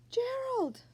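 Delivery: high, sharp call of his name. Acted. Calling out to Gerald.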